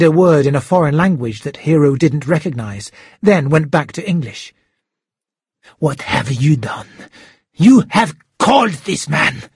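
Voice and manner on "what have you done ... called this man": villain's anger rising from a measured growl to an outburst; boiling with anger. A narrator's reading. Shark discovering Hero has called for help, boiling with anger. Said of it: "the shark was boiling with anger".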